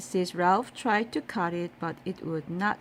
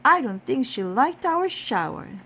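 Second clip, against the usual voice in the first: innocent remark; 5 semitones higher, 6 dB louder than usual. A narrator's reading. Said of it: "said Amelia Bedelia".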